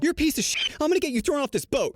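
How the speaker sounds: high-pitched Karen tirade; mock yelling. Angry mother threatening the storyteller, mock yelling. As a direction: imitate a furious woman, pitch pushed way up. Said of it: "Karen starts yelling".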